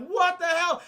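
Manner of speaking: high, loud outburst of disbelief; hammed-up horrified astonishment; imitating onlookers aghast at a monster; mock shocked yell. Acted shocked reaction to creature.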